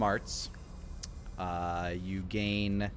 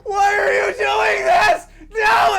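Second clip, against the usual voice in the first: screaming in terror; terrified scream; 14 dB louder, slower than usual. Acted. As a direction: scream in panic as you're dragged. Victim dragged through portal.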